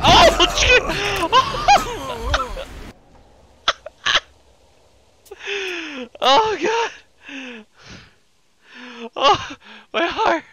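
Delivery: high shrieking fright; scream, then laugh it off; jump scare shriek. Real, not acted.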